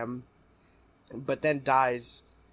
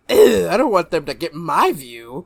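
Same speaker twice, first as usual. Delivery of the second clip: mock disgust; 3 semitones higher, 11 dB louder than usual. Mocking friend's refusal.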